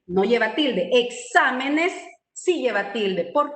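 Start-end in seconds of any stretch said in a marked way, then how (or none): 0.9-1.9 slow, careful "Exámenes" with the weight on XÁ; drawn-out "Exámenes" with the stress on XÁ. Shown as a demonstration. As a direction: stretch out "Exámenes", leaning on the antepenultimate syllable. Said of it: "la mayor fuerza de voz recae en la antepenúltima".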